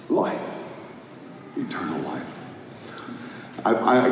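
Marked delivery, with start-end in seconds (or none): 0.1-2.2 "Life. Eternal life." murmured soft and low; hushed musing. Acted. Preacher musing to the congregation, soft and low. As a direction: let "Eternal life" fall almost to a hush.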